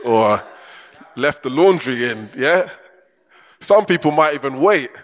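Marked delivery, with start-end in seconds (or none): none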